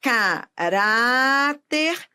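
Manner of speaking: very slow Caráter, RÁ held long; stress on penultimate syllable. Shown as a demonstration. Teacher showing a paroxítona, RÁ drawn out in Caráter. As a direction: break up Caráter and stretch RÁ. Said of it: "a sílaba tônica é a penúltima sílaba".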